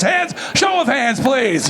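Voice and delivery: high shout for a show of hands; calling out. Real, not acted. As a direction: call the room to raise hands. Asking crowd for hands.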